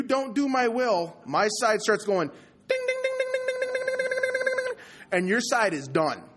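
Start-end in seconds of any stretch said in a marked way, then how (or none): 2.7-4.8 high, rapid dinging bell imitation; imitated bell dings. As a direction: ring it out like a scoreboard bell racking up points. Scoreboard bell ringing imitated.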